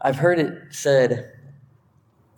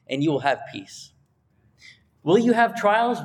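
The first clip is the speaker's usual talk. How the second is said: higher, fervent sermon voice; preaching; 6 semitones higher than usual. Acted. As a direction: rise into heartfelt exhortation.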